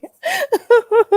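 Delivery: shrieking laugh; high laughing cry. Real, not acted. Host cracking up at a viewer's comment, high squeal.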